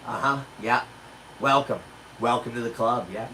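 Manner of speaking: put-on low, soft, understated welcome from an old-timer; knowing old couple. Older couple welcoming him to old age, low knowing voice. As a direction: imitate a quiet, knowing nod of approval.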